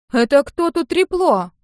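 high, loud, drawn-out demand; indignant challenge. A narrator's reading. Sister confronts whoever called her names, indignant. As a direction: call out the accuser, high and loud.

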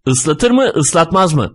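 high, tense demand; mild angry snarl through the teeth; snarled question. A narrator's reading. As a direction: demand it angrily, pitched high. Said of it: "diye hırladı".

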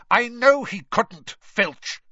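loud, high irritated snap; snapped. A narrator's reading. Snape snapping at Filch, loud. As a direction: cut him off sharply. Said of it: "Snape snapped".

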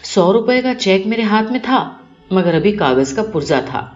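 usual speaking voice

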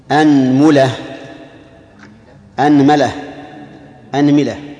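أنملة with fatha on the hamza, then a, u, i on the meem; teacher drills أنملة, hamza with fatha, meem in three vowels; anmula with a vowels. Shown as a demonstration. Teacher drilling students in the vowels of أنملة, pronunciation demo. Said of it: "أولا خذوا فتح الهمزة وتثليث الميم".

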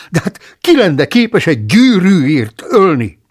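loud, high, horrified question; alarmed outcry. A narrator's reading. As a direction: cry out in disbelief. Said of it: "kiáltott fel". (hangos, magas, döbbent kérdés)

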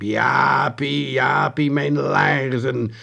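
slow, drawn-out spectral call; dark figure on the TV screen calling the boy, menacing. A narrator's reading. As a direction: loom closer and call his name. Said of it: "met dreigende stem riep".

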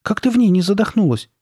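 high, amazed question; exclaiming. A narrator's reading. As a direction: ask in astonishment, pitch well up. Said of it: "воскликнула она".

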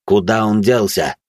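usual speaking voice

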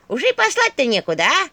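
high, loud taunting staff; venomous. A narrator's reading. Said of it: "ядовито произнесла Черепуша". (ядовито, высоко и громко)

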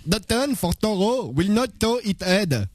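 slow, hammed-up Flemish-sounding English; accent de Ping et Pong. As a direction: read it slowly in a comic Belgian accent. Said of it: "l'accent de Ping et Pong". (accent flamand caricatural, lent et appuyé)

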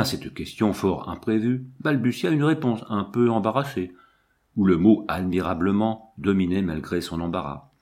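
usual speaking voice

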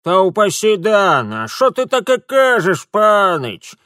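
high, loud indignant granny; offended old woman. A narrator's reading. Old woman objects to Mitya's guess, offended cry. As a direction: protest as if personally hurt. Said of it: "Бабка обиделась".

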